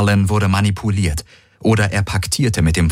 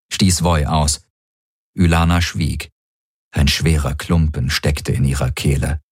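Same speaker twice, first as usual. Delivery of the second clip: ordinary delivery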